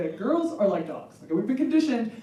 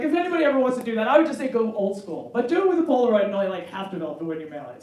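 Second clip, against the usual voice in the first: usual speaking voice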